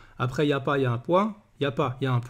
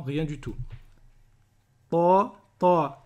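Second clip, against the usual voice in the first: ordinary delivery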